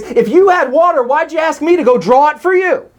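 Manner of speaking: high, incredulous scoff; scoffing woman. Retold or imitated. Preacher voicing the Samaritan woman scoffing at Jesus, high and incredulous. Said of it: "what she's saying is".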